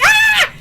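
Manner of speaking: very high, loud mock shriek; excited fan scream. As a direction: squeal at full pitch as the reader. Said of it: "I'm like".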